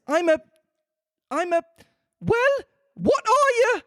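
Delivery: pigeon complains about serpents; high pigeon voice. A narrator's reading.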